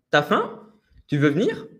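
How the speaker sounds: t'as faim? and Tu veux venir? ending high on faim and venir; high rising questions. Shown as a demonstration. Teacher modelling rising questions for learners, ending high. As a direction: push each question up into a high finish. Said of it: "on va avoir tendance à monter dans les aigus".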